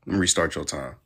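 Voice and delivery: usual speaking voice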